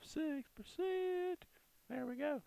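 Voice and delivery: squeaky high "There we go"; voice goes up high. Real, not acted. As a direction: pitch jumps way up. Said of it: "Voice goes up high".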